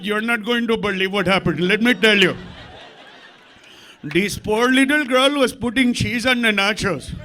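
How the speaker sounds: a hint of a dramatic TV reporter; news guy. Guy on the news announcing a story, mock newscaster. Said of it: "there's a guy going".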